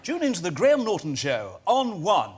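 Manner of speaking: punchy announcer plug; promo voiceover. Shown as a demonstration. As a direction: sell the show like a TV trailer. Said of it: "could you do one for Graham's show".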